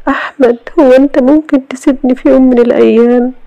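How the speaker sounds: high tearful woman's voice, pleading; Iman tearful. A narrator's reading. Wife begs her husband never to leave, tearful and high. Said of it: "وهي بتبكي".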